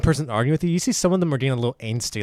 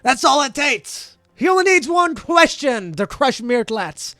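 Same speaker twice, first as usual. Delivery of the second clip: high, drawn-out heckling yell; loud, slow jeering from the jury; yelling back; 10 semitones higher, 6 dB louder, slower than usual. Acted. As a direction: yell it across the courtroom at your opponents. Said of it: "the opposing jury yells back".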